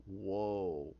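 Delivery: low, soft, drawn-out reaction; quietly floored; stunned awe. Retold or imitated. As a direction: let it out slowly, low and hushed. Richard stunned, listening.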